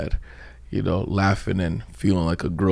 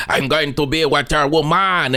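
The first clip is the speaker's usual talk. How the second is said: higher, exaggerated Quebecois English; 6 semitones higher than usual. Comedian copying a film character, comic Quebec accent. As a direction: imitate a silly French-Canadian lover, pitched up. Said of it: "was doing the Quebec accent".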